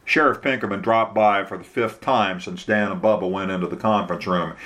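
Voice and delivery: ordinary delivery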